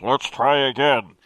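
low, slow distorted demon voice. Acted. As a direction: speak through a warped mask. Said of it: "he said, voice distorted".

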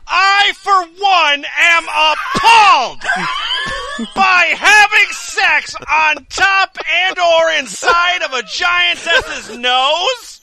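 high, slow outraged shouting; mock outrage. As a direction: proclaim it in loud mock horror.

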